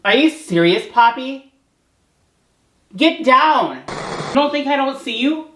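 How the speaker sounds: high, loud angry scolding; real fury at the cats for interrupting; angry yell. Real, not acted. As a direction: vent at the cats in real anger. Scolding cat on ceiling.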